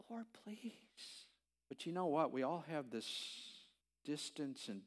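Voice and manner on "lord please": a breathy hushed plea; a husband whispering a desperate prayer, soft and quiet; whispered prayer. Retold or imitated. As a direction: whisper it to God.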